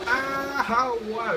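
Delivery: high exasperated cries; outraged disbelief. Real, not acted. Gamer baffled after dying. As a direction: demand an answer in disbelief.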